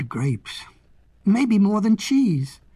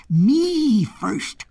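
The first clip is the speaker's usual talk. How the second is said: goat's cry; 3 semitones higher, 5 dB louder than usual. A narrator's reading. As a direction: cry out as the insistent goat. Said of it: "cried the goat".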